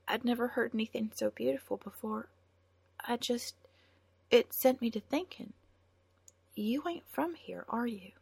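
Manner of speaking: put-on a poor girl moved by a song; shy farm girl. A narrator's reading. Sarah voiced, wistful and shy.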